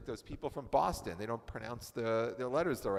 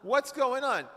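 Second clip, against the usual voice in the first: exasperated husband; 10 semitones higher than usual. Acted. Acting out the frustrated husband.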